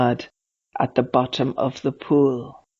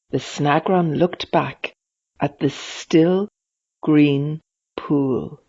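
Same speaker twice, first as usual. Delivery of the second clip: usual speaking voice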